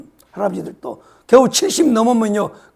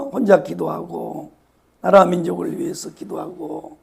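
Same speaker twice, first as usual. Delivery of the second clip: normal talking voice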